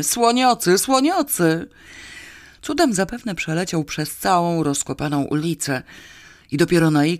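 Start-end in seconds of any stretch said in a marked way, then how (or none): 0.0-1.7 high, loud cry of panic; piercing scream. A narrator's reading. Man fleeing an elephant in panic, screaming piercingly. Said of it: "krzycząc przeraźliwie".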